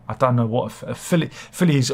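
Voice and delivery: ordinary delivery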